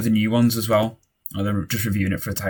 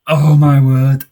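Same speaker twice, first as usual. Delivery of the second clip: loud, raised cry of astonishment; thrilled disbelief at high volume; amazed exclamation; 6 semitones higher, 14 dB louder, much slower than usual. Real, not acted. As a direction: belt it out, stunned by the goal. Amazed right after the goal.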